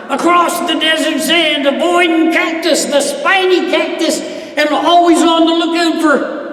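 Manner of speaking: loud, high, driving the story forward; excited galloping narration. A narrator's reading. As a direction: tell it like you're riding full tilt. Galloping again, told excitedly.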